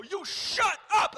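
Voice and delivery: high, loud, drawn-out command; yelling. Retold or imitated. Comic yelling at a heckler, slow high shout. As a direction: silence him with a slow, furious shout. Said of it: "As I'm yelling at him".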